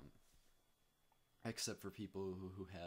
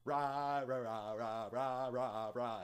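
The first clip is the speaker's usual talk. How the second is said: loud high robotic Rah chanting; blaring mock synth Rah rah rah; horrible utau imitation; 6 semitones higher, 11 dB louder, slower than usual. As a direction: ham up a broken voicebank's R. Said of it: "horrible utaus going".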